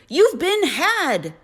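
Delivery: loud, high, slowly stretched outrage; furious roar. A narrator's reading. Mother telling Jack he was tricked, furious high roar. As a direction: roar each word out at him. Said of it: "she roared".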